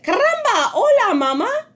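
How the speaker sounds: very high, loud tiny chick; baby chick voice. A narrator's reading. Baby chick greets Jack, very high and loud. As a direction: squeak it like a newborn chick. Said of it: "and said".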